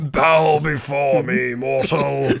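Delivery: slow commanding god voice; dark lord. Acted. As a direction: boom it out slowly like a wrathful deity. Ra addresses Texan as god.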